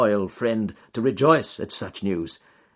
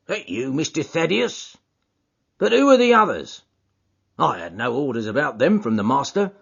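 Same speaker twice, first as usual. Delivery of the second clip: lightly raised stubborn doorkeeper; porter McMurdo; 7 semitones higher, 4 dB louder than usual. Acted. Porter refusing Thaddeus's friends, lightly stubborn. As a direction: stand firm at the door.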